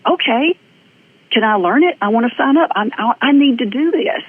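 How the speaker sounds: can't wait to sign up; eager. Retold or imitated.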